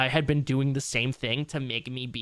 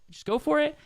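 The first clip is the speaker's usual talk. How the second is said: Just go for it lifted much higher; higher pitched encouragement; 13 semitones higher than usual. Real, not acted. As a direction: push it up high, cheering them on. Encouraging repeat, second take raised.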